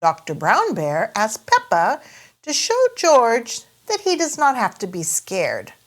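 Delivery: ordinary delivery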